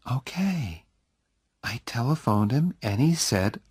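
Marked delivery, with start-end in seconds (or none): 0.1-0.8 lightly voiced young woman, higher and softer; a light, offhand okay from Jenny; Jenny, breezy. A narrator's reading. Jenny shrugs off Oliver's worry, higher and soft.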